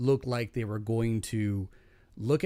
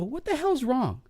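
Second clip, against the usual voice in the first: quoting himself; 11 semitones higher than usual. Retold or imitated. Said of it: "I look at him and go".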